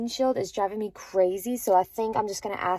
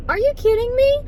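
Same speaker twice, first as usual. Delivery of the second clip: much higher, louder cry of disbelief; mock disbelief; 13 semitones higher, 5 dB louder than usual. To viewers about the sudden cold, high mock disbelief. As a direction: squeal it like the cold is a personal insult. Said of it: "I'm like".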